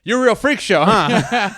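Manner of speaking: ordinary delivery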